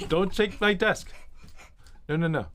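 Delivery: normal talking voice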